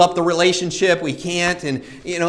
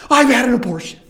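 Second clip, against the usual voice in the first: high, anguished confession, acted; raised, pained voice of a retold admission; distressed confession; 6 semitones higher, 4 dB louder, faster than usual. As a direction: imitate someone blurting out a painful secret.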